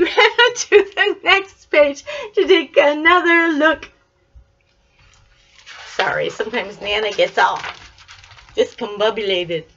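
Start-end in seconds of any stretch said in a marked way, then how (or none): none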